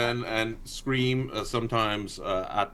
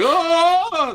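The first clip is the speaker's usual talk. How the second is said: high, loud fake gasp of fright; mock startled shout; 10 dB louder than usual. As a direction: jolt out a fake startled cry. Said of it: "Go like".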